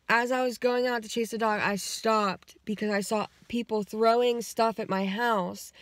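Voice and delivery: usual speaking voice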